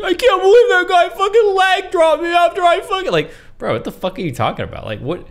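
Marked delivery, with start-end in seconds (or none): 0.0-3.1 high, laughing mock of a whiny gamer. Streamer mocking a crybaby gamer, high and laughing. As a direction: whine it like a crybaby, high and giggling.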